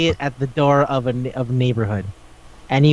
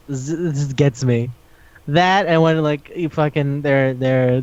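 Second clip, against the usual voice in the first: ordinary talk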